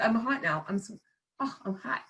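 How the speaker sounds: usual speaking voice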